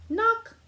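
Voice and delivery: high, loud knock call. A narrator's reading. Narrator acting the knock at Amu's door, high and loud. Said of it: "someone was at the door".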